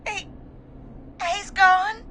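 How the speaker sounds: high-pitched, anguished delivery; grief-stricken. Acted. Caller tells host his friend is dead, grief-stricken. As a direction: force out the awful news.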